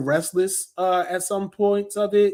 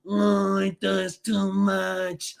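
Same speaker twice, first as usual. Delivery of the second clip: slow, softer mock bored critic; 4 dB quieter, much slower than usual. Vlogger mocking negative reviewers, slow bored drone. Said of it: "saying, like".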